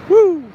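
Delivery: high, loud "woo"; mock cheer. Joker cheering his own joke, high mock whoop. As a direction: whoop it up after a corny joke.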